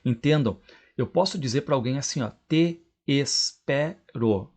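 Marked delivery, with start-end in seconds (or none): 2.5-4.5 "te espero" very slowly as te, es, pe, ro; four separate syllables. Shown as a demonstration. Teacher demonstrating four syllables, "te espero" as te-es-pe-ro. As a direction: keep both e sounds apart in "te espero". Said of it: "falar isso aí em quatro sílabas".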